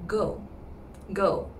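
plain go sound. Shown as a demonstration. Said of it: "like a G O".

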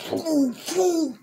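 high vroom vroom like a revving engine; revving engine noise. Retold or imitated. Telling friends how the cars gunned it, engine vroom. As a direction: rev it up with your voice.

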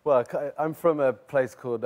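usual speaking voice

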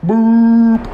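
mock boat beep; high loud boop. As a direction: toot like a small boat. Boat horn imitated.

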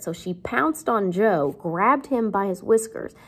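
ordinary talk, nothing marked

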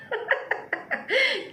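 high, derisive burst of laughter; mocking laugh. Real, not acted. Ridiculing rival's boast of hundreds of boys, mocking laugh.

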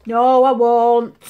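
loud, slow defiant denial; imitating daughter. Daughter to mum, loud mock defiance. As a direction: brush off mum, hammed-up. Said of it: "I kept saying to her".

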